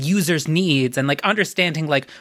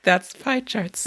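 slow mock slogan; 4 semitones higher, much slower than usual. Mock slogan for pie charts.

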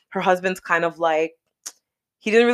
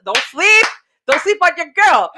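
hype; 11 semitones higher, 8 dB louder than usual. Acted. Hyping herself up.

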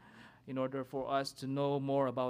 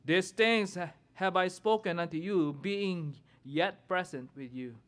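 scripture reading; 4 semitones higher, 3 dB louder than usual. Acted. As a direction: proclaim the verses from the pulpit. Reads scripture aloud, responsive reading.

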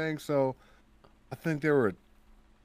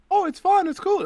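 much higher, louder mock-breezy voice; high, loud, breezy imitation of fans; mocking naive fans; 7 dB louder than usual. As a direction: parody people who think it's easy. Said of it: "I think people think".

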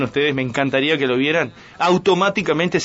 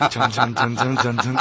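ominous dun-dun sound; 5 semitones lower, slower than usual. Retold or imitated. Ominous sound effect with laughter.

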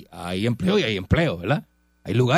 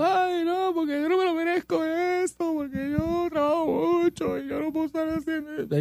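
very high falsetto whine; mock whining; 5 dB quieter than usual. Boss mocking workers who feel overworked, high whiny falsetto. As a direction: whine in a squeaky put-on voice. Mimicking complaining, entitled workers.

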